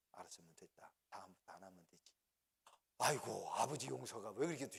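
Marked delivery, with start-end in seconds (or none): 0.1-2.1 hushed, gentle fatherly forgiveness; much softer, calm father. Retold or imitated. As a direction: let him off tenderly, almost under breath.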